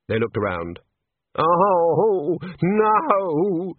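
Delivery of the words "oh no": high, long-drawn moan; moaning dismay. A narrator's reading. Senior wrangler spots housekeeper in danger, dismayed moan. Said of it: "the senior wrangler moaned".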